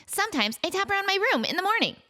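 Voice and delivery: high, fast imitation of her child self; chirpy high-pitched kid mimic; high annoying voice. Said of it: "my voice was so high and annoying".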